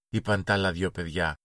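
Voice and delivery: plain reading voice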